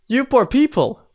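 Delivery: high, pitying you poor people; mock pity. Real, not acted. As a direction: coo with exaggerated sympathy. Pities viewers of the long fight.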